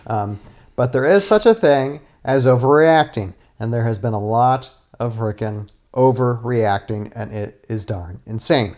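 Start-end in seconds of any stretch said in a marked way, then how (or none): none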